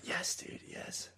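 breathy whispered agreement; whispering. Real, not acted. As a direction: whisper it, barely any voice.

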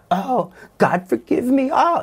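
high, sneering mock of his own plea; mocking demon voice. Mocking voice imitating him as he prays in tears, high and sneering. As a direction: parody the crying prayer in a shrill high sneer. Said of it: "a mocking voice".

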